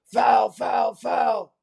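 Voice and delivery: mock sideline yell from a coach; hammed-up courtside coach shouting; Tibbs impression. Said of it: "That was my best Tibbs impression".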